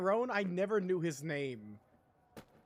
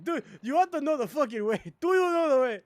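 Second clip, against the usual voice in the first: high mock-vicious shout in an African accent; Ugandan Knuckles impression; 7 semitones higher, 10 dB louder, faster than usual. Streamer imitating memes getting nastier, vicious accented shout. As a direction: yell it, building to hammed-up fury. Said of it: "I love it when they get more and more vicious".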